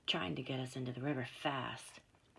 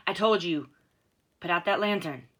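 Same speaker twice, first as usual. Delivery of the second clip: loud angry snap; furious command to put out the light; snapped; 2 semitones higher, 13 dB louder than usual. A narrator's reading. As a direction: bark the order at them. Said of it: "the man snapped".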